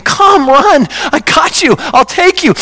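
high, eager call to come running; joyful welcoming Jesus. Acted. Jesus calling to someone turning from the cliff, joyful and high. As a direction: urge them in, pitched high with joy. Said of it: "I see the smiling, laughing".

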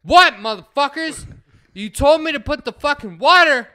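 high, loud furious outburst; imitating an angry friend yelling; pissed-off yell. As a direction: shout it back in outrage. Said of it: "he answers all pissed off".

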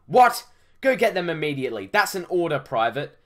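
high, loud outburst into rapid orders; commanding officer. Acted. Officer ordering a private to fetch the artifact, shouted.

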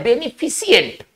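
"बेनिफिशिएंट" as be-ni-fi-si-ent; common mispronunciation. Shown as a demonstration. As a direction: end "बेनिफिशिएंट" in two syllables, si-ent. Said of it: "इसको भी गलत पढ़ देते हैं". ("बेनिफिशिएंट" का गलत उच्चारण, फि-सि-एंट)

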